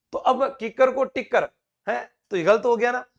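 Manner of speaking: ordinary talk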